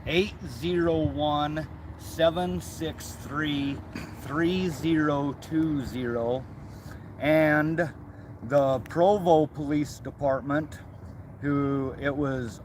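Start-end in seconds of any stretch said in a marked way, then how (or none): 0.0-6.5 slow, spelling out each digit; slow dictation. Real, not acted. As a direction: read the number out slowly for viewers. Dictating a phone number.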